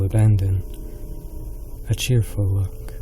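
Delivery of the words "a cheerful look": usual speaking voice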